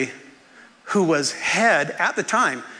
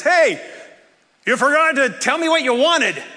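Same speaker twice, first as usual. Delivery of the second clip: higher-pitched retold call-out; calling after someone; 6 semitones higher than usual. Old teacher calls after departing student, calling out. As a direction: call him back from across the room.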